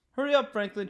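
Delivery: shouting. A narrator's reading. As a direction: call out to a dawdling friend. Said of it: "shouted his friends".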